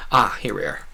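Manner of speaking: ordinary delivery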